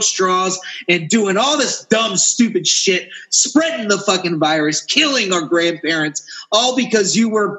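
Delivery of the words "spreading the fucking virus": usual speaking voice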